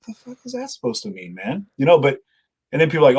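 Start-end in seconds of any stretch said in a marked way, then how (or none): 0.1-1.7 incredulous. Retold or imitated. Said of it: "and I was like".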